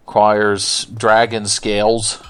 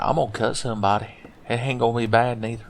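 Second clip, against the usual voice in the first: character's talk; 9 dB quieter, faster than usual. Acted. Player voicing his own game character threatening someone, put-on voice. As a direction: quietly imitate a folksy threat. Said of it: "I like how that's how he talks".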